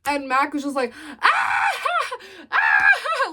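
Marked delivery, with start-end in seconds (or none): 1.2-3.3 high mock laughing squeals; imitated laughing fit. Imitating Mac laughing hard at them, high squeals. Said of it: "just laughing so hard".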